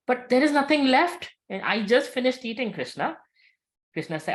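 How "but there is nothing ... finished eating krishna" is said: high, exasperated pleading; acted protest. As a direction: protest helplessly, starting high and coming down. Draupadi protests that food is finished.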